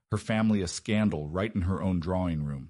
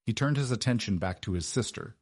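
plain reading voice

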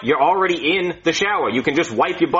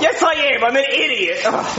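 higher, half-laughing misery; miserable; 10 semitones higher than usual. Acted. Doctor insisting he is an idiot, higher and miserable. Still berating himself.

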